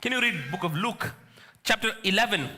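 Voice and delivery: ordinary talk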